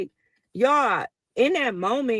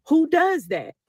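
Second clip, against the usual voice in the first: incredulous; 6 semitones higher, 4 dB louder than usual. Real, not acted. Disbelief at her own act.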